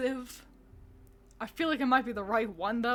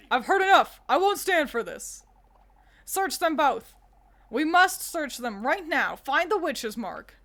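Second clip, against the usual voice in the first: louder, higher commanding outburst; angry elder; 4 semitones higher, 6 dB louder than usual. Acted. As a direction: order the search in fury. Angry elder orders search.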